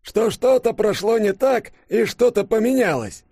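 put-on very high old professor, a bit loud; slightly too loud. A narrator's reading. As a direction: blurt it in a high elderly voice. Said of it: "возможно, чуть громче, чем следовало".

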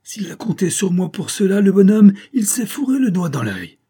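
high, loud, defiant retort; indignant outburst. A narrator's reading. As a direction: flare up in scornful anger. Saphir's furious outburst at betrayal. (colère indignée, voix haute)

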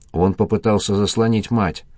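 usual speaking voice

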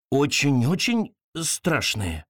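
plain reading voice

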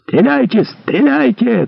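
high, drawn-out cry; high-pitched frantic command; shouting. A narrator's reading. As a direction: scream the order to fire, stretching it. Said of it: "кричал Мордаунд".

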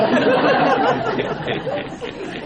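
long, high-pitched laughter. Real, not acted. Monk recalling wanting to run away, long high laugh. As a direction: laugh helplessly at your own tale. Laughs at his own story.

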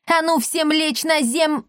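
high, drawn-out order, cut off abruptly; bossy command. A narrator's reading. Villain orders everyone down, cut off. (протяжная команда высоким голосом)

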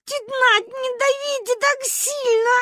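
very high, slow wailing plea; howling. A narrator's reading. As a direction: beg through a long wail. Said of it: "выла Маня".

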